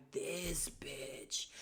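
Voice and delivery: unvoiced whisper, disbelieving; whispered aside. The cast's silent judgment, a whispered aside. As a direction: whisper it under your breath.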